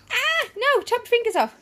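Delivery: sudden high alarmed cry; alarmed warning. Real, not acted.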